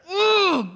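high, loud excited whoop; excited ooh. Retold or imitated. Pastors whooping at their big plan. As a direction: squeal it with excitement.

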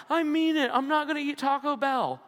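high, pleading promise; mock solemn vow. Preacher imitating himself swearing off the valley. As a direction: swear it off in a whiny voice.

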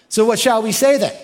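mock objector posing the question; objector's question. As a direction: ask it like a puzzled skeptic.

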